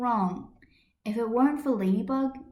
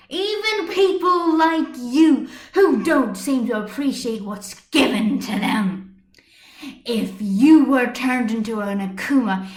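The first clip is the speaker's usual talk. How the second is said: loud, higher furious defense; furious tirade; 3 semitones higher, 7 dB louder than usual. Acted. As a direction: shout down the man, fierce and loyal. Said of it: "still not done with her tirade".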